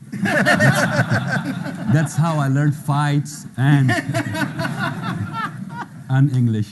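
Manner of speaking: laughing through the words at his own joke. Real, not acted. Telling the audience about Commando while laughing. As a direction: chuckle out each phrase, giggling between. Joking he learned English from Commando.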